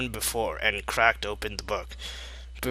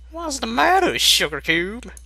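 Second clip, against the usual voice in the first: much higher, louder country mare; high Applejack voice; 13 semitones higher, 4 dB louder than usual. Acted. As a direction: squeak it out as the farm pony.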